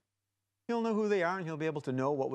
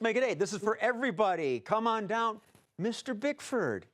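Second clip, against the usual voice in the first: game show host; 9 semitones higher than usual. Acted. Game show host calling contestant.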